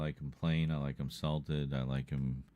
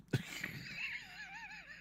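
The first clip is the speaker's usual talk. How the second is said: monster-like laugh; 11 dB quieter than usual. Real, not acted. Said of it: "Why did that seem like a Ray Harryhausen monster?".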